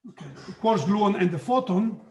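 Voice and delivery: usual speaking voice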